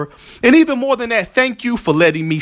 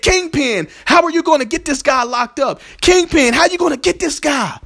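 higher, urgent pleading calls to Kingpin; pleading henchmen; 9 semitones higher than usual. Retold or imitated. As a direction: press him for an answer.

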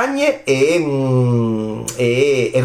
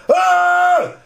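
excited shout; 3 dB louder than usual. Real, not acted. Cheering the winner's name.

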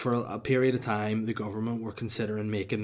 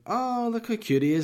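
wife cooing, much higher and louder; 13 semitones higher, 6 dB louder than usual. Retold or imitated. Mimicking his wife cooing at the mouse, high and loud. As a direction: gush over the cute mouse. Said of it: "she was like".

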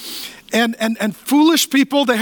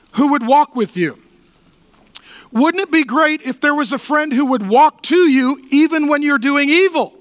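rising preaching; 6 semitones higher, 3 dB louder than usual. Acted. Pastor appealing to the congregation before communion, preaching. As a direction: lift the room toward the invitation. Builds to altar call.

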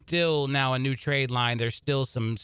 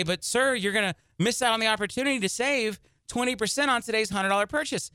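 higher-voiced store clerk pleading a sales offer; store clerk's pitch; 8 semitones higher than usual. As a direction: lightly act out a clerk's higher-pitched objection. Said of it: "And they'll say".

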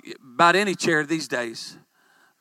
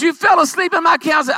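higher, louder, fast accusation; indignant shout; 9 semitones higher, 6 dB louder, faster than usual. Retold or imitated. Secretary scolding the pastor for dozing off. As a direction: scold him like a shocked secretary. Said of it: "She says".

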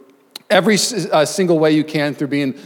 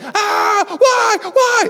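much higher, shouted cry of despair; anguished yell; much slower than usual. Retold or imitated. On the ride as his phone dies, retold shouted wail. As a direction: wail it like the phone just died.

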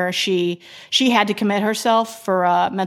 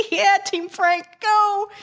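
fan cheer; 11 semitones higher, slower than usual. Real, not acted. Cheering for a favourite contestant.

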